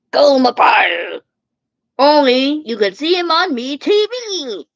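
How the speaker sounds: shouted TV announcer pitch; booming ad voice; loud promo. Pretend. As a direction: sell the channel, loud and high.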